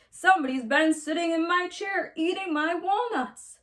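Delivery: papa bear voice. A narrator's reading. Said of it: "Papa Honey Bear growled in his great big bear voice".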